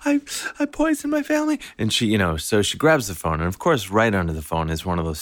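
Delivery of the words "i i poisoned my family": very high shrill panicked mom voice. Retold or imitated. Mom freaking out to the family, high panicked. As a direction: squeal it in a fast panic. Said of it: "she's freaking out".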